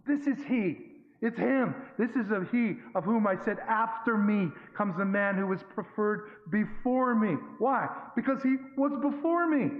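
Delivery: high, loud, ringing declaration; fervent proclamation. Acted. John announcing the Lamb of God to crowds, loud and high. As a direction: proclaim it to the whole riverbank.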